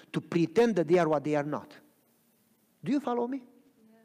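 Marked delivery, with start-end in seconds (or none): none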